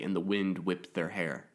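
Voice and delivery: plain reading voice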